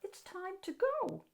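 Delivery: put-on high, soft little girl. A narrator's reading. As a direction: speak like a small child gently explaining. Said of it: "a little girl told him".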